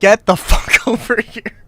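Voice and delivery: high loud laughing bark; mock furious yell. Streamer voicing a rude client, mock furious shout. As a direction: yell the order through a laugh. Acting out an abusive mercenary client.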